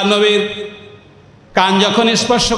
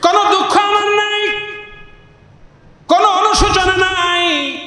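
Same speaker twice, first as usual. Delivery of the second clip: high chanted declarations, easing then rising; widow, fervent plea; 8 semitones higher than usual. Acted. Widow assures the Prophet she has no regret, fervent plea. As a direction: proclaim it in high, ringing phrases. Preacher voices the pleading widow.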